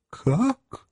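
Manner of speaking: high, innocent surprise; righteous astonishment. A narrator's reading. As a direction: feign wounded surprise. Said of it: "с видом праведного изумления". (праведное изумление, высоко)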